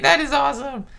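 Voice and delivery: high-pitched real excitement; thrilled, high squeal of approval; delighted. Real, not acted. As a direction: let your voice leap up with delight. Delighted at a viewer's birthday coincidence.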